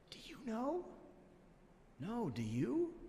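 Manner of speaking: hushed, questioning aside; mock whisper. Baffled wise men confer with each other, in a hushed mock whisper. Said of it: "whispered to each other".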